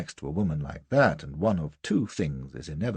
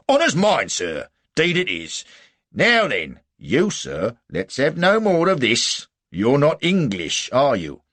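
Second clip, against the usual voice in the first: loud, raised soldier's voice; hearty, commanding sergeant; 7 semitones higher, 8 dB louder than usual. A narrator's reading. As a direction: take charge like a sergeant.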